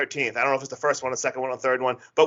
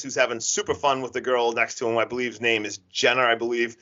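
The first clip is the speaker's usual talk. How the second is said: usual speaking voice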